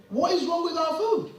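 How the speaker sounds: ordinary talk, nothing marked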